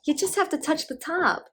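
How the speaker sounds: high-pitched mock instruction; acted correcting. Daughter correcting her mother.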